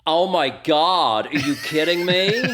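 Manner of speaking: put-on American accent, loud and drawn out; mock American twang. Guest jokingly answers whether he has picked up a twang, mock American accent.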